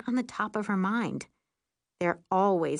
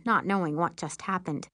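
usual speaking voice

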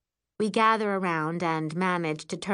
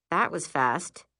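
usual speaking voice

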